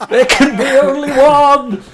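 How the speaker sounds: high, very loud mock-epic shout; heroic battle cry. Employee capping his absurd review, triumphant shout. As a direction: roar it out as a triumphant catchphrase. Film catchphrase climax amid laughter.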